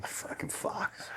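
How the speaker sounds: whispered, slow, under his breath; a hushed curse aside; muttered curse. Real, not acted. Host curses the head coach under his breath, whispering.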